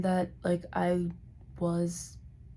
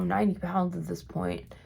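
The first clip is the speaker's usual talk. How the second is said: normal talking voice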